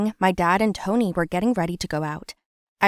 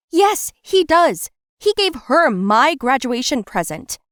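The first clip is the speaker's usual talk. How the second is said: much higher, louder, slow defiant protest; stubborn daughter snapping back at mom; indignant retort; 6 semitones higher, 6 dB louder, slower than usual. Acted. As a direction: dig in and fight back.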